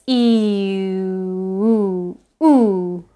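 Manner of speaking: long "E" sliding back to a central "oo"; halfway vowel glide. Shown as a demonstration. Teacher says start at E and go backwards, halfway glide. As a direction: draw "E" out, stop "oo" halfway back. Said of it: "Start at E and go backwards".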